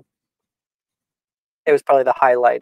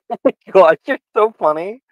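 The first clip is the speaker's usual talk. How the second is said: high, loud, gushing flirt; laying it on thick; flirty giggly voice; 8 semitones higher, 8 dB louder than usual. Acted.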